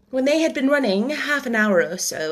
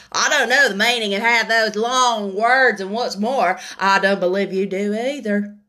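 louder, lightly voiced cheeky bird; cheeky eaglet; 3 dB louder than usual. A narrator's reading. The eaglet teases the Dodo, cheeky. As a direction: tease with a hidden smile.

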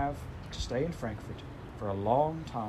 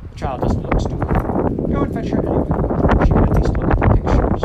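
louder, higher orders in a put-on old lady voice; firm grandmother; 5 semitones higher, 15 dB louder than usual. The grandmother orders the housekeeper to fetch Heidi, loud and firm. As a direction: brushes the complaint aside firmly.

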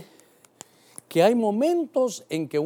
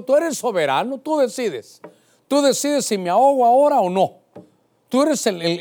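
raised, forceful prayer; emphatic declaration; 5 semitones higher, 5 dB louder than usual. Acted. Preacher voicing Peter acknowledging Christ's sovereignty, emphatic. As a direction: declare it with rising conviction.

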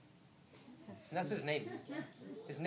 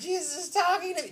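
put-on high, loud little-kid squeal; imitated giddy child; 11 semitones higher, 6 dB louder than usual. Laughing little Isaac telling of Jesus, imitated giddy excitement. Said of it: "he's just laughing".

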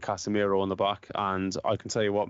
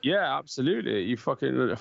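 usual speaking voice

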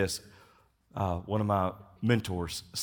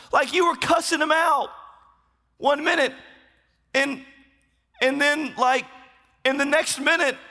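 much higher and louder, near shouting; amazed excitement; 7 dB louder than usual. Acted. Preacher imagines greeting the thief in heaven, much higher, louder, amazed. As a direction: burst with disbelieving joy.

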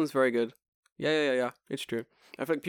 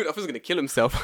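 ordinary talk, nothing marked

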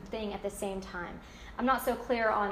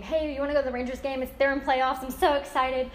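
excited, louder quick yes to the game; mock excited yes; 4 semitones higher, 4 dB louder than usual. Friend invited to the Rangers game, gushing excitedly. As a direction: gush about going to the Rangers game.

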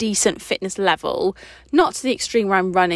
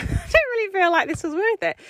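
laughing quote; 11 semitones higher than usual. Retold or imitated. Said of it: "And I was like".

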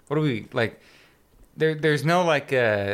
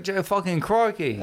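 put-on British accent slipping into Australian; high, badly faked British-Australian accent; Alan Rickman; 5 semitones higher than usual. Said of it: "Why do you sound Australian now?".

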